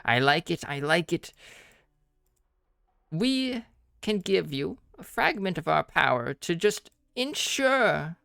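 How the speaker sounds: put-on high, sly old hag voice. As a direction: purr it like a scheming crone.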